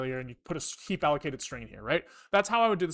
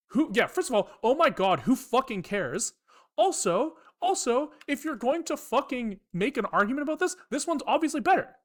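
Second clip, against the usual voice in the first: exasperated outburst; 7 semitones higher than usual. Retold or imitated. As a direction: let the disbelief boil over as the voice climbs. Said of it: "And I'm like".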